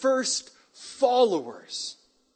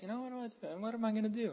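much softer, under-the-breath thinking aloud; musing to himself; 4 semitones higher, 12 dB quieter, much faster than usual. Acted. David voiced as he privately wonders what to do, musing softly. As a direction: think it out very quietly.

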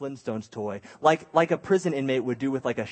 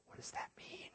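hushed, mock-fearful question; whispered alarm; 22 dB quieter than usual. Child puzzling over mom's threat, anxious whisper. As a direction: whisper it like a worried kid.

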